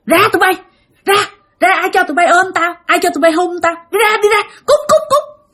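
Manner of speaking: put-on high-pitched angry tirade, driving children away; scolding mother. Possessed mother chasing off her two children, angry high scolding. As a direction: shoo them off in a high, rising scold. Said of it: "mẹ là mẹ chửi".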